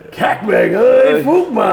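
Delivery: loud, high raspy holler; hammed-up raspy call; extra raspy imitation. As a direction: mimic fans with exaggerated rasp. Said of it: "They put the extra rasp in there".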